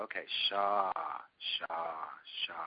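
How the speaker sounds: low, hushed, drawn-out syllables; student repeating mantra. Retold or imitated. Student copying the guru's mantra, intoned low. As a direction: echo the mantra obediently, spaced out. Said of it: "and I go".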